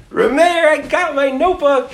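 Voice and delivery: put-on high-pitched gleeful yeshiva kid calling out; thrilled boy's shout from down the road; excited teenage boy. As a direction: call it out high, proud and carefree. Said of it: "like, sixteen-year-old Bacher".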